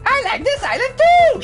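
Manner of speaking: put-on very high, loud little girl; high childlike voice. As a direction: chirp it out like a kid. Young dancer voiced.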